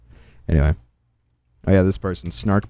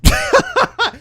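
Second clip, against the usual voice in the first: very high-pitched burst of laughter. Real, not acted. Streamer laughing at a self-contradicting post, high squeal.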